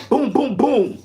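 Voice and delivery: high, loud booms hammered out; emphatic booms. Acted. Counting the deaths off to his viewers, loud punched booms. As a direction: slam each boom down like a blow.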